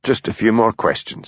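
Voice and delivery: usual speaking voice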